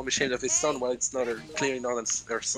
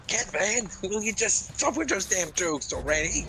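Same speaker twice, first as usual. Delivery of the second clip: higher, exasperated scolding; fed-up Elisa; 8 semitones higher, 4 dB louder than usual. Retold or imitated. Elisa scolding Kevin over his jokes, exasperated. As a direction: scold him like a tired mom. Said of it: "and she's like".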